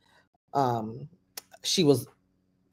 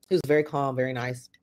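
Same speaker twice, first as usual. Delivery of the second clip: ordinary talk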